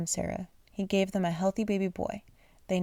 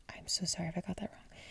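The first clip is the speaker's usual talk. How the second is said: barely voiced, hushed sorry; whispered apology; 12 dB quieter, faster than usual. Real, not acted. Reader whispers sorry for a misread word, hushed. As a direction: whisper the correction aside.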